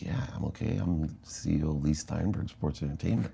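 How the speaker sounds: arrogant. Retold or imitated. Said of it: "in my still arrogant mind".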